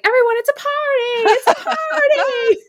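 high, loud hammed-up party cheer; coach hyping party. Imitating coach covering his yelling, hammed-up cheer. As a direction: sell it like a panicked coach. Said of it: "he's just like".

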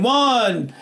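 high, drawn-out shout of the count; crowd counting aloud. Acted. Preacher imagines onlookers counting the dips, excited high call. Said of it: "I imagine the people going".

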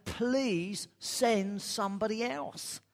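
slow, high begging plea; pleading. Acted. Moses begging God to send someone else, slow and high. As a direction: stretch it out, begging to be let off.

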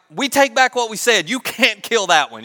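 loud, high, pleading protest; frantic retraction. Acted. Brothers begging to save Benjamin, frantic retraction. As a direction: burst out as if desperate to undo it.

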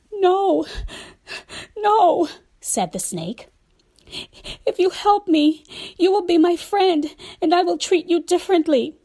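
high, loud begging snake; urgent pleading snake. Acted. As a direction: insist desperately, keep pleading. Snake insists, begging again.

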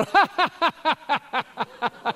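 a sudden high burst of laughter. Retold or imitated. Laughing at the wife remark.

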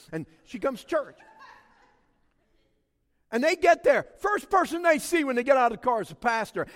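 loud, high, driving preacher's voice; full-force preaching. Acted. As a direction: preach it hard to the whole church.

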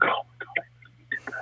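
airy, spent half-whisper; drained disbelief. Retold or imitated. After a hard bag workout, a spent, breathy half-whisper. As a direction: breathe it out like you're emptied. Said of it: "you finish the workout and you're like".

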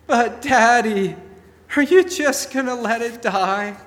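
put-on high, excited kids' voice begging Daddy; pleading children. Children in the back seat begging Daddy to stop the car, high and pleading. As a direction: play a child tugging at Dad to stop.

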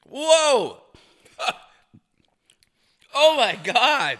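high, loud shocked whoa; shocked exclaim. Real, not acted. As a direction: gasp it out, overwhelmed. Reaction to strong shot.

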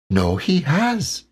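high, slow little Piglet voice. Acted. Piglet agreeing with Pooh, high and small.

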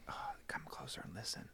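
airy mock whisper, very soft; playful spooky whisper. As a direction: lean in and hiss it playfully, like a storyteller. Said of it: "I was just laughing".

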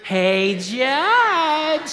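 high, drawn-out "judge"; widow's call, led together. Pretend. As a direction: lead the crowd in the widow's holler. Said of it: "let's all say it together".

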